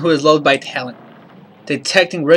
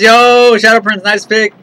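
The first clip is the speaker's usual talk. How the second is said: excited shout-out; 5 semitones higher, 6 dB louder, slower than usual. Real, not acted. Greeting chat viewer loudly.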